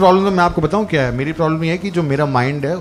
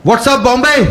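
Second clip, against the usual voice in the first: high, loud crowd hype-up; hyped stage greeting; 7 semitones higher, 4 dB louder, slower than usual. Retold or imitated. Comic yelling to the Gurgaon crowd, high and loud. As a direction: greet the crowd like a rock star. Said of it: "बाहर जाके audience को बोल रहा है".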